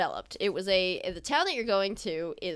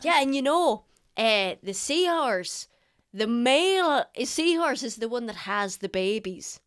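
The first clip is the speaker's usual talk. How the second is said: put-on eager old sailor; 2 semitones higher, 3 dB louder than usual. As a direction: gush about sea creatures like a proud old sailor. GM voices the sailor husband.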